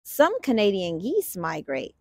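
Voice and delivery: ordinary delivery, nothing marked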